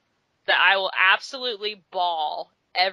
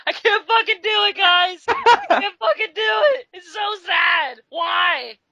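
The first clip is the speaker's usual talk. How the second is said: high, loud hammed-up bawl, slowing at the end; imitating herself sobbing at the movie; mock wailing cry; 10 semitones higher, 6 dB louder, slower than usual. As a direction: play the overwhelmed crier, half-joking. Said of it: "Are you really crying?".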